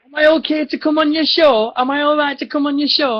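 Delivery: high-pitched imitation of an eager pest; pestering stranger. Retold pestering guy asking.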